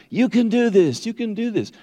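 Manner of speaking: high-pitched cheerleading; pumped-up encouragement. Acted. Preacher imitating people pumping everybody up, high-pitched hype. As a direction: pump them up, pitch right up high. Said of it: "this kind of pump everybody up thing".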